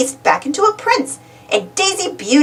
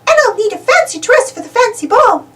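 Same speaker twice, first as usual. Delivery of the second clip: high, bright little mouse voice; sweet high-pitched Minnie; Minnie's voice; 9 semitones higher than usual. Acted. Minnie suggesting a story to friends, high mouse voice. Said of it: "asks Minnie-rella".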